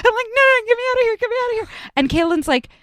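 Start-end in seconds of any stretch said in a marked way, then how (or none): 0.0-1.7 very high frantic begging; panicked pleading. Retold or imitated. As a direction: plead to escape in terror. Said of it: "I'm like".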